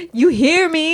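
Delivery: high, loud pretend demand; mock yelling cat. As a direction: holler it as the indignant cat.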